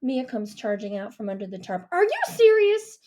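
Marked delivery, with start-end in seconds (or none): 1.9-3.0 put-on loud, high, outraged girl; furious girl's challenge; indignant outburst. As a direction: throw it back at him in disbelief. Mia outraged at Deke.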